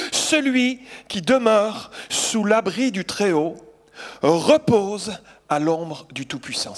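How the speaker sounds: slow, solemn scripture reading. Shown as a demonstration. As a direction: draw out each phrase of the psalm. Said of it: "voici la parole que j'aimerais vous apporter". (lecture lente et solennelle du psaume)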